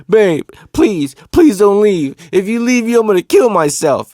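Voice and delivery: high, loud imitation of desperate begging; mock pleading. Cousin calls his girlfriend to stop her leaving, mock pleading. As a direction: plead as if selling a fake breakdown. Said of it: "his old bag of tricks".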